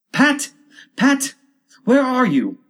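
high, loud angry call; cross, high-pitched rabbit voice. A narrator's reading. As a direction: shout angrily for a servant. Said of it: "Next came an angry voice, the rabbit's".

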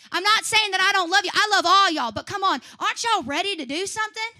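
usual speaking voice

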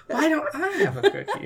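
put-on high, plaintive little kid asking; whiny child voice. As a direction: whine it like a child. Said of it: "she's like".

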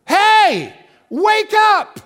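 high, loud wake-up shout; jolting shout. Real, not acted. Preacher shouting to jolt the drowsy congregation awake. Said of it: "we just need to be jerked up a little bit and shook".

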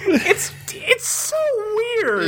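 high, breathy, disbelieving whine; high disbelief. Real, not acted. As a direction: squeal how weird it is. Reacting to the weird ending.

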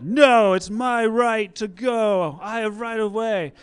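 loud, high, stubborn insisting; indignant shout. Retold or imitated. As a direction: defend your right of way loudly. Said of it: "I'm like".

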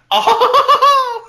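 very high cackling laugh; maniacal cackle. Acted. Villain laughing about bombing the studio, high cackle. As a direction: let out an evil cackle.

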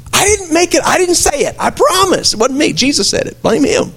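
high, mock-innocent denial; playful protest. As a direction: play innocent for laughs. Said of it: "joking disclaimer".

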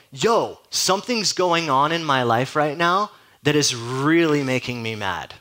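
a touch of angry frustration, slowed down; mildly angry complaint. Acted. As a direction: play the aggrieved man in the crowd, drawn out. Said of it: "This guy appears to be a bit angry".

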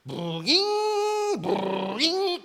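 high cartoon boing of the boat hitting waves; boing sound effect. Pastor mimicking the boat slamming waves, high boing. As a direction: pitch jumps way up for a bouncing boing. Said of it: "and it was like".

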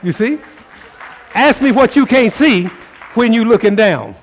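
high, loud, fervent sermon; rising preacher's fire; full-force preaching. Acted. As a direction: drive it home to the congregation.

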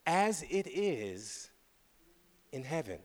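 hushed echo. Acted. Preacher echoing the phrase to the congregation, hushed. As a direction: let it land softly.

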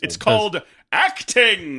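high, loud, drawn-out theatrical declaration; mock-grand thespian. Co-host joking about the short boy's stage skills, mock-grand. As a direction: proclaim it slowly like a hammy actor.

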